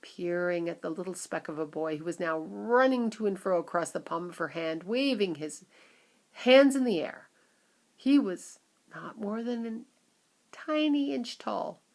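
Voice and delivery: usual speaking voice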